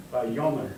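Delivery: "Vayomer" with the yo syllable stressed. Shown as a demonstration. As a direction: hit the yo in "Vayomer". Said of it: "Now put the accent on the yo".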